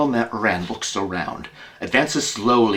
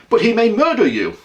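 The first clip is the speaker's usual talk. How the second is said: alarmed friend; 12 semitones higher, 7 dB louder, faster than usual. Acted. Watson alarmed for Holmes.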